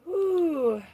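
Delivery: high, loud exhaled whew; loud relieved whoop; big whew. Real, not acted. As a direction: blow out a high whew of release. Catching breath after emotion.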